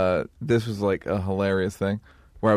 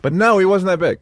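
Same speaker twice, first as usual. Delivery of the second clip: laughing reply; 10 semitones higher, 6 dB louder than usual. Real, not acted. Laughing answer about bodyguard.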